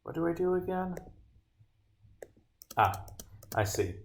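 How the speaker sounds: usual speaking voice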